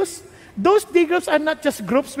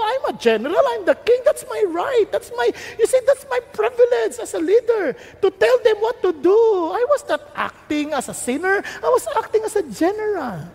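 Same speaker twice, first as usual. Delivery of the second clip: higher, proud protest; higher, defiant self-defence; self-justifying king; 8 semitones higher than usual. Retold or imitated. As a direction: insist on your right as king. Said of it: "inutusan ko".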